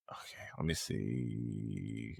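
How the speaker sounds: whispered, slow, under his breath; slow muttered whisper; whisper to self. Real, not acted. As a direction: whisper so the stream barely hears. Muttering to himself, checking.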